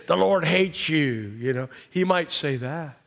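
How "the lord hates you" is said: slow, drawn-out devil's taunt. Retold or imitated. The devil lying to a believer, slow and taunting. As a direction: voice the accusing devil, stretching each word. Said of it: "He'll say".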